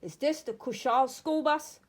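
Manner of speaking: loud demanding shout from a gunman. Acted. Gunman shouts at the bus driver, loud demand. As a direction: call it out hard across the bus. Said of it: "A man shouts to the driver".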